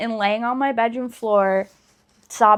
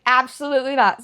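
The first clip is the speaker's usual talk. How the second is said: retold shout. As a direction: shout it flat out, no way. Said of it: "I screamed because I was like".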